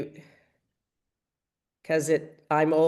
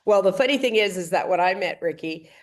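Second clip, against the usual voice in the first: ordinary talk, nothing marked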